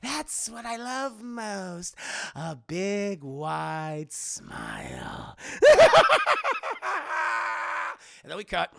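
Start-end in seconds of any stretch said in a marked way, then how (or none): 0.0-5.4 very drawn-out cartoon villain; Joker. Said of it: "He's like". 5.4-7.9 loud, very high cackle; long hammed-up Joker cackle; maniacal villain laugh.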